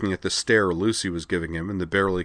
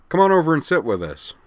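high, loud friendly call; calling over; 9 semitones higher, 6 dB louder than usual. Acted. Dyson calls Kozue over to sit with them, loud and friendly. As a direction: wave her over to the table.

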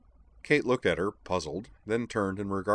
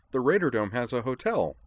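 higher-pitched, eager assurance; insistent; 6 semitones higher, 4 dB louder than usual. A narrator's reading. Edward solves the problem of where to stay, insistent. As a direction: press the easy solution. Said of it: "Edward insisted".

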